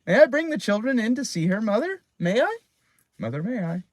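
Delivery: high, fast excited pleading; a child rushing her request; eager girl. A narrator's reading. Excited girl asks to show friends.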